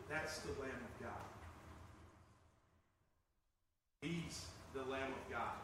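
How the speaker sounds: hushed reverent announcement; hushed declaration. Retold or imitated. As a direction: say it in awe, quietly. Quietly voiced John.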